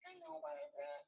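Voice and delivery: very high, soft, slow squeak; mock meek little voice; tiny little guy. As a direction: shrink into a timid little man. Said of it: "So I'm little guy".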